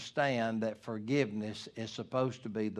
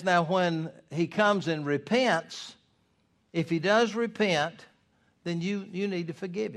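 raised preaching voice; preaching at full force; 6 semitones higher, 4 dB louder than usual. Real, not acted. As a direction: drive it home to the congregation. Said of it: "preaching at full force".

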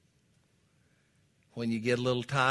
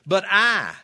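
loud, high, heavy "I"; stress on I; 8 semitones higher, 11 dB louder than usual. Shown as a demonstration. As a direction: land with full force on the word "I". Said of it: "That's the emphasis of the phrase this time".

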